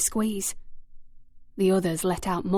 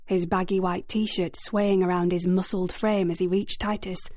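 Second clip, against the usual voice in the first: usual speaking voice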